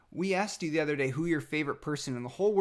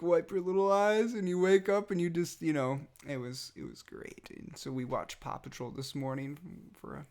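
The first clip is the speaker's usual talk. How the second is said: normal talking voice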